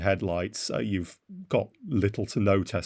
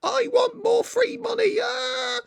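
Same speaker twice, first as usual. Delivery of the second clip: shrill mock whine, much higher and louder, slow; mocking protester shout; 4 dB louder, slower than usual. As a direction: parody a spoiled protester yelling demands. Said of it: "They're all shouting, going".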